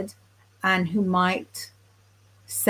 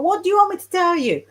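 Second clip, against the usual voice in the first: high, fast mock shout down the phone; retold shouting psychic; 10 semitones higher, 3 dB louder, much faster than usual. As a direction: replay her impatient yell, pitched way up and quick. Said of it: "She was shouting down the phone".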